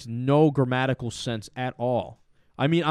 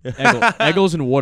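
high mock Philly vowels; Delco accent; 4 semitones higher, 7 dB louder than usual. Shown as a demonstration. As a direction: imitate Philly "Eggles" and "water". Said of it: "that Delco".

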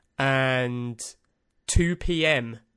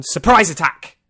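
spring it on them with a loud yell; sudden shout; 4 semitones higher, 11 dB louder than usual. Pretend. To listeners, band name bursting out as a loud yell. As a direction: blurt it out loud and high like an ambush.

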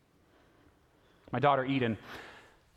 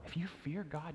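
ordinary talk

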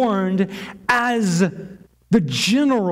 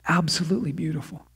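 hushed awe; 4 semitones lower, 7 dB quieter, faster than usual. Real, not acted. Awed remark after scripture.

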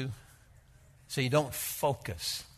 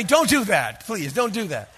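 higher, much louder comic plea; loud mock warning; 7 semitones higher, 9 dB louder than usual. Imagined piano warning the preacher off, loud mock plea. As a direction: blurt the warning like a panicked plea.

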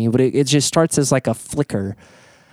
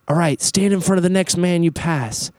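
high-pitched call, no added volume; imitated yelled command; 7 semitones higher than usual. Retreat leader directs the circle of men, imitated yell. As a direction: mimic a leader calling out orders. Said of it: "he would yell out".

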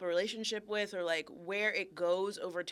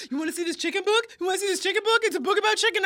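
higher, louder mock taunting; teasing husband; 9 semitones higher, 7 dB louder, much faster than usual. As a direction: needle her like a playful husband. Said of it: "Patrick was like".

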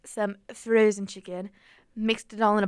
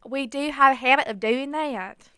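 higher, louder put-on Southern twang; Southern accent; 5 semitones higher, 5 dB louder than usual. Host admits the accent habit, Southern accent. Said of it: "I have turned you on to the Southern accent".

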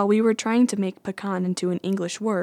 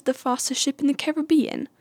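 higher-pitched bad British accent, Caribbean as care-uh-BEE-un; badly faked British accent, stressing BE in "Caribbean"; Elizabeth Swann; 6 semitones higher than usual. Vlogger imitating a film heroine's real line, bad British accent. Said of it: "worst accent I've ever done".

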